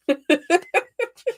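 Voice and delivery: high, rising giggle; real laugh. Real, not acted. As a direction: giggle after teasing. Laughing after teasing viewers.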